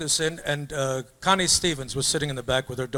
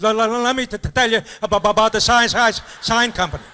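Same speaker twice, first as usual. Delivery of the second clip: stammering owner; 6 semitones higher, 3 dB louder than usual. Retold or imitated. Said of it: "He said".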